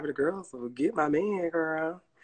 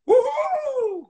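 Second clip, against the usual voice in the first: high, loud cheer of joy; squealed woo-hoo; excited whoop; 6 dB louder than usual. Real, not acted. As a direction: whoop it out for the dog. Cheering dog on to new yard.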